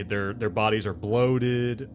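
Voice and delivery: ordinary talk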